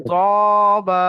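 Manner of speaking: ত and বা each held long, ত with rounded lips; one-alif stretch. Shown as a demonstration. Teacher models letters for students to read along, one-alif stretch. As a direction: draw out ত, then বা, one beat of stretch each. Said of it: "ত বা এক আলিফ করে টান".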